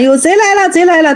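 narrated high yell, little added volume; high-pitched cry of alarm. A narrator's reading. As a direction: raise the alarm in a high storytelling voice. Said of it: "长老高喊".

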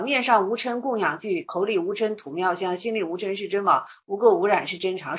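measured chanting of a teaching verse; verse recitation. Acted. The boy giving the monk parting advice, recited as verse.

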